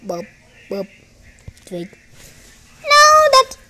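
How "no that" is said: high, loud "no"; sudden high yelp of protest; dismayed cry. Real, not acted. Upset at the game.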